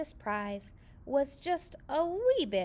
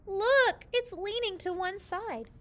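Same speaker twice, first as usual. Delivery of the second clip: put-on children crying out; 9 semitones higher than usual. A narrator's reading. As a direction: point out the lean with a startled cry. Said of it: "the children cried".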